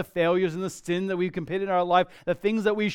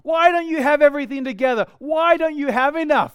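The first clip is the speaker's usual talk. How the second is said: loud, high, indignant questioning; hammed-up exasperated reproach; mock berating; 7 semitones higher, 6 dB louder than usual. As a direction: act out a scolding, harsh judge. Mock God berating believer.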